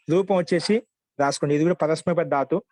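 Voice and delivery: usual speaking voice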